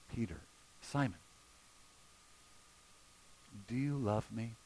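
low, soft voice with long pauses; hushed, gentle, searching question; tender question. Acted. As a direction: ask it quietly, giving each name space. Jesus quietly questions Peter.

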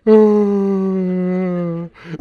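exaggerated helpless cry; mock wailing. Husband left standing in the hall, mock wailing. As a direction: gasp into a long high wail.

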